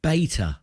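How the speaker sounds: BAY-tuh with a long A; British beta. Shown as a demonstration. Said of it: "I thought you meant better".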